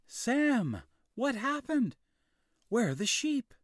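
high, loud calling out; worried mother. A narrator's reading. Mother finding Sam on the mountain, worried. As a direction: call out searching in the dark. Mother finds boy at night.

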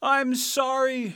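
high, loud, slow call; shouted. A narrator's reading. Balloonist calls down to Dorothy, shouting. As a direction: call out an apology from afar. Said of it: "shouted the balloonist".

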